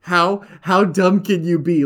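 slow, loud and incredulous; exasperated disbelief. Real, not acted. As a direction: stretch it out in disbelief. Mocking Wolverine fighting Magneto.

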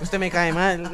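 put-on a loud, playful jab in a local Colombian accent; mocking regional accent. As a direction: fling the line in a mock local accent. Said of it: "Qué acento tan feo".

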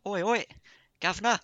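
high, loud mock Cockney English accent. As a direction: bark it out in a hammed-up English accent. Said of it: "She was like".

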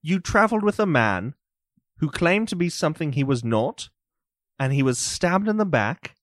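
pin the suspect down; interrogating magistrate. Acted. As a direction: Morden grills Reese about the stabbing, suspicious. Morden interrogating.

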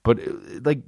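usual speaking voice

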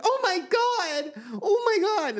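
very high, drawn-out mock horror; hammed-up high-pitched shock; mimicked shocked waitress. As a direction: squeal it like a horrified server. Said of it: "then the waitress comes out".

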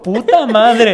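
susto. Retold or imitated. Asustados ante la pistola.

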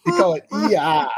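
put-on repeating E R in New Zealand accent. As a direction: echo "E R" like a Kiwi. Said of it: "that New Zealand accent".

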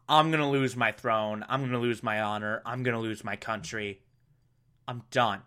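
low, subdued resignation; solemn. Acted. Zuko gives up on capturing the Avatar, solemn. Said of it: "Zuko has this solemn moment".

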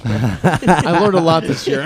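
rueful laughing joke. Real, not acted. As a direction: laugh through the line, slow. Joking about bombing.

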